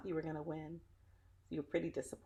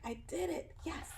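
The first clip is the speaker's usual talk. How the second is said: much higher, bright victorious little cry; triumphant cheer; 14 semitones higher, 3 dB quieter than usual. Shown as a demonstration. Showing children Wilma's triumph, high joyful cheer. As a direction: show the children a proud winner's smile. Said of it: "Let me see your triumphant smile".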